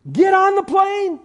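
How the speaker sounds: high, loud urging call; high, ringing command; preaching exhortation. Acted. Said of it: "Works is ridiculous".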